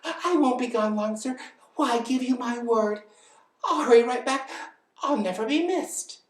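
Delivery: high, loud pleading bird; begging bird. Acted. Bird promises elephant a quick return, high pleading. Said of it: "begged the bird".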